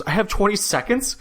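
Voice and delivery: high-pitched mock disbelief; incredulous. Imitating Biz shown his shot clock, incredulous. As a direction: question it in imitated shock, pitch jumping up.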